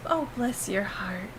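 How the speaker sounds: soft, slow "bless your heart" said as a quiet dig; knowing insult. Retold or imitated. Now knowing the Southern meaning, a quiet sly dig. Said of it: "fuck you" in Southern".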